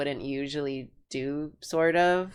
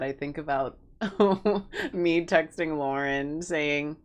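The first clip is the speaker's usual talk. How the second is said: ordinary talk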